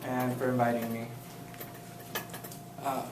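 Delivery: ordinary talk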